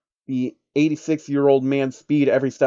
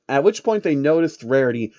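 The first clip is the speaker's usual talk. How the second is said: ordinary delivery, nothing marked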